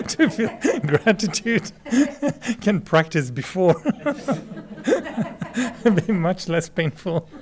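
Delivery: real laughter on a joke; laughing. Real, not acted. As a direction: giggle high through the words. Jokes about dentist, laughing.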